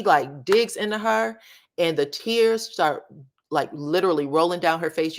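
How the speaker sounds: ordinary delivery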